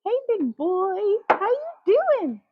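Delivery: high, slow coo to a bird; sweet talk to a bird. Real, not acted. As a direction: coo at the crows like a pet. Greeting crows in the road.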